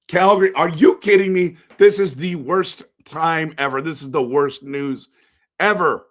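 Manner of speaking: loud, high outburst of disbelief; outraged disbelief. Real, not acted. Fan reacting to his rival winning first pick, loud outrage. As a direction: yell at the screen in outrage.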